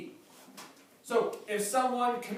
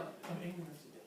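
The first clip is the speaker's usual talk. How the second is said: put-on childish sulk; 4 semitones lower, 14 dB quieter, faster than usual. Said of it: "Very childish".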